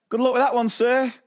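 high mock-cheery good luck; sarcastic TA. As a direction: toss it off like a smug colleague.

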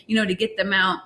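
ordinary talk

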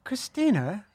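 "Christina" ending as if in E R; mock-British "Christina", rising at the end. Shown as a demonstration. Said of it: "With an E R".

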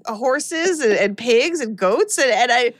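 ordinary talk, nothing marked

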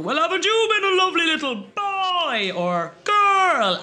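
very high, sing-song Santa voice; hammy jolly Father Christmas. Retold or imitated. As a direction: Santa cooing to a child. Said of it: "the Santa Claus was going".

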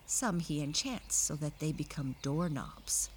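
plain reading voice

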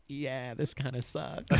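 slow, quiet mock letdown; softly deflated, dragging it out; dejected self-talk. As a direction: mutter it slowly, underwhelmed by your own work. Letdown at own photos, acted.

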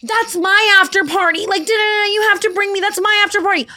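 very high, loud entitled-friend wail; mock whining. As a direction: whine a demand like a drunk brat. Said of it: "biggest pet peeves in the entire world is whiny tone".

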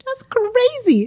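high slow "That's crazy."; drawn-out, high-pitched disbelief; mock outrage. Echoing the outrage.

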